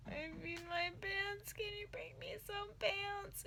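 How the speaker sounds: high, soft, slow crying plea; tearful plea. Retold or imitated. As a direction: beg mom on the phone through tears. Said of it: "I'm like".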